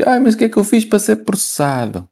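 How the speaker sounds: high, whining innocent protest; whiny complaint. Retold or imitated. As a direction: plead innocence in a high whine. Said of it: "queixam-se que".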